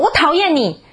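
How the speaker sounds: mock tantrum shout from a small son; high, petulant kid's cry of defiance; child's loud outburst. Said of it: "然后很大声说".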